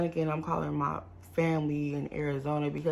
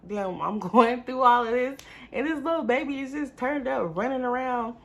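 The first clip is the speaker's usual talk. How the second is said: laughing disbelief; 7 semitones higher than usual. Real, not acted. Laughs at toddler during crisis.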